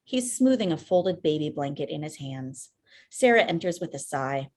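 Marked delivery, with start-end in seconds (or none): none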